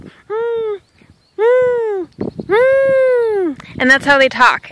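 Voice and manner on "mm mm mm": high hummed beaver squeaks; squeaky humming like a little beaver; beaver noises. Retold or imitated. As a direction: imitate tiny beaver hums, much higher. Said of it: "they make little noises like".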